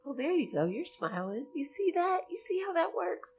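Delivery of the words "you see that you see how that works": playful tease. Real, not acted. Teasing the smiling listener.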